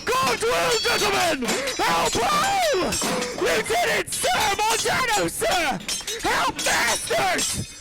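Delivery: high, urgent calls over a brawl; alarmed crying out. Acted. Bystander tries to stop fighting officers, high alarmed cries. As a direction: call for help in rising panic.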